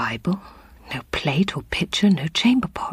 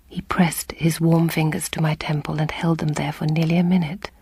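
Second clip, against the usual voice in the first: ordinary delivery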